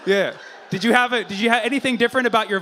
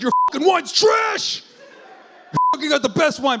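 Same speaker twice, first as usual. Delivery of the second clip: high, loud trash-talking shout; hammed-up indignant yell; mock outraged boast; 9 semitones higher, 4 dB louder than usual. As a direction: yell it like a rude wine snob. Acts out other countries bragging.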